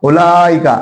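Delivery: उलाइका with ला held long, इका short; madd. Shown as a demonstration. Teacher repeats madd example, उलाइका with long ला. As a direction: stretch ला in उलाइका before the fall. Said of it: "ये मद".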